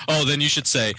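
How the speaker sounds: ordinary talk, nothing marked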